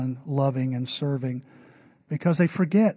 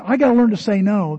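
higher, louder mocking of a self-pitying excuse; mock complaint; 5 semitones higher, 6 dB louder than usual. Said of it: "when I hear people say".

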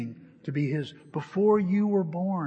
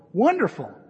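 higher, louder burst on one word; acted delight; 4 semitones higher, 6 dB louder than usual. Customer hearing the one tire is there, delighted. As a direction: exclaim it with a swooping lift.

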